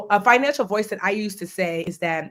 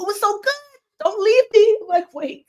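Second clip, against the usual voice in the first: frustrated; 12 semitones higher than usual. Real, not acted. Forgets her point mid talk.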